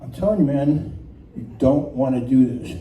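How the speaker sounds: low-voiced horse giving a warning. Horse warns rookie rider with a look, low-voiced warning. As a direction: drop the pitch and warn him off. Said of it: "he gives me a second look".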